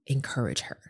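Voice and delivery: low, hushed near-whisper; softly whispered instruction, retold; Holy Spirit's prompting. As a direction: whisper it like an inner nudge. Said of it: "the Holy Spirit, like, made me".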